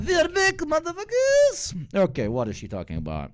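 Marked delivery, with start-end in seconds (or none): 0.0-1.9 high, drawn-out hammed-up cry; mock triumphant shout. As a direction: belt it like a villain returning, dragging each word. Hated video returns, mock triumph.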